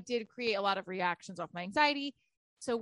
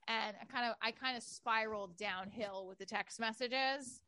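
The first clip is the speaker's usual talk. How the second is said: normal talking voice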